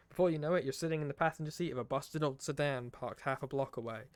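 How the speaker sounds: usual speaking voice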